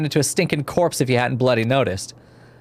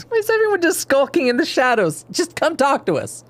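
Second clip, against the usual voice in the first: high exasperated call; 12 semitones higher than usual. Real, not acted.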